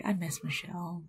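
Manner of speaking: tearful. Real, not acted. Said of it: "starting to possibly cry".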